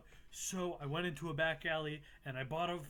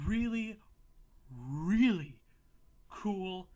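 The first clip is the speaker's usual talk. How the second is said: excited emphasis; 4 semitones higher, 8 dB louder, slower than usual. Real, not acted. Gushing about a cool yokai.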